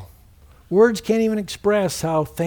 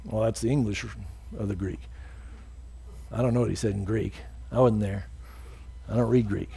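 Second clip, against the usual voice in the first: normal talking voice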